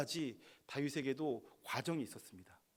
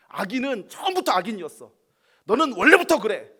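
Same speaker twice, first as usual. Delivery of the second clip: much higher, loud angry yell; furious shout; 8 semitones higher, 15 dB louder than usual. Acted. David cursing the wicked, furious shout. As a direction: blast it out in a rage. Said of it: "막 이렇게 지금 화를 내는 것입니다".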